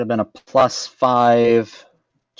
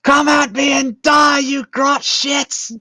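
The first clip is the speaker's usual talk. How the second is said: high, roaring war cry in character; shouted battle challenge; 5 dB louder, slower than usual. Acted. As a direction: bellow a champion's taunt at the enemy. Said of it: "issue a champion challenge".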